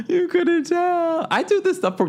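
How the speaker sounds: very high, slow mock squeak; playful squeal. Real, not acted. Host teasing viewers about her own joke in a squeaky voice. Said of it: "joking about herself".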